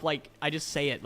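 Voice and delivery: ordinary talk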